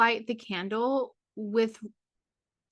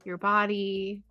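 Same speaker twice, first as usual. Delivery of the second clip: guided meditation. Acted. Guide leading a full moon journey into the heart, guided meditation. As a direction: ease listeners inward, phrase by unhurried phrase.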